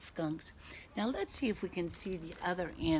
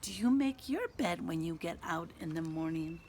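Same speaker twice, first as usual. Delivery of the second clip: ordinary delivery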